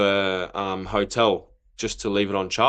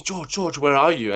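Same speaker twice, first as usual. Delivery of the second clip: urgent call; 6 semitones higher than usual. Retold or imitated. Coach urgently calling player late.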